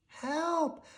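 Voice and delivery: high, desperate cry for help from a hole. A narrator's reading. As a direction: call out high and scared. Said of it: "he cried".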